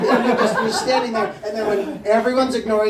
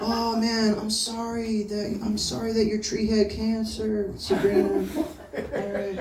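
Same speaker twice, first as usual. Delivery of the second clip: ordinary talk, nothing marked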